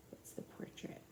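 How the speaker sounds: soft unvoiced question; whispered aside. Real, not acted. Teacher whispering to the class about the portrait.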